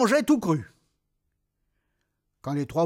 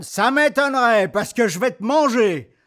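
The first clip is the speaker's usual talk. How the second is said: loud threatening troll voice; 5 semitones higher, 7 dB louder than usual. Acted. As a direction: menace like a hungry troll. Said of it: "dit le troll".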